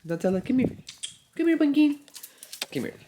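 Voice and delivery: high-pitched pet call; calling the dog. Real, not acted. Owner calling her puppy over, high and coaxing.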